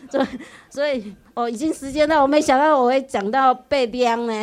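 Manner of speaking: loud, high, laughing through the words. Real, not acted. Speaker laughing to the audience as time runs out. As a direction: giggle in embarrassment while wrapping up.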